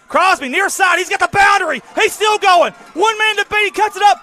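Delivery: very high, very loud shouted play-by-play; screaming touchdown call. Real, not acted. Announcer calling the winning touchdown, full-throated shout. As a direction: scream the touchdown like the stadium just erupted.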